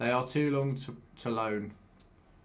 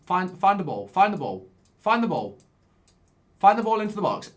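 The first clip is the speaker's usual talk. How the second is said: urging on; 5 semitones higher, 5 dB louder than usual. Real, not acted. Urging player during attack.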